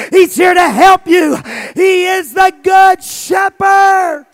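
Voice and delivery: high, loud shouted preaching; fiery revival preaching; preaching at full force. Acted. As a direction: whoop it up from the pulpit.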